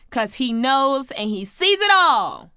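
ordinary talk, nothing marked

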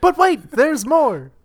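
high, loud "but wait" pitch; infomercial announcer. Acted. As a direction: hype it like an infomercial host. Infomercial catchphrase in mock ad.

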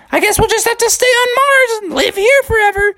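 very high, hammed-up crying voice; mock sobbing high bear voice; crying Junior Bear. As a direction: wail it like a sobbing cartoon cub.

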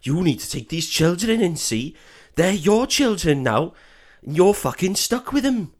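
lightly voiced bossy Welsh woman; a hint of Welsh scolding. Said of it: "she's just like".

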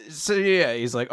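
ordinary delivery

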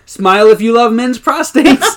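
high, loud mock exclamation; mock cheery outburst. Coworker pops up at a passing mother, mock cheery outburst. Acting out Toby accosting a woman.

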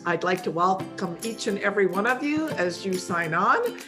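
normal talking voice

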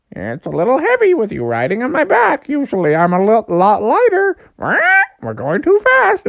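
high squawky bird complaining; parrot-like goose. Acted. Goose carrying a heavy rider, squawking. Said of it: "Why do you talk like a parrot?".

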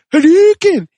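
very high, loud video-game shout; mock attack cry. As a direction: yell it like a fighter launching a fireball. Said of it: "is like".

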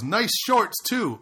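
mocking heckler. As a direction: taunt him from the porch. Voicing the heckling drunk.

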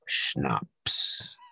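low, breathy, menacing attack word; growled command word. Pretend. Friend announcing the fans' attack word, low and hushed. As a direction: hiss the trigger word like a secret command.

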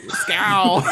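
toast shouted through laughter, high and loud; giggling Skål shout; laughing toast. Real, not acted. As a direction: laugh while calling the toast. Toast call, answered.

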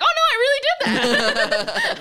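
very high, loud mock-horror through laughter; laughing mock dismay. Ex-diver recalling seeing the fall, high laughing mock dismay. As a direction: squeal your own reaction while cracking up.

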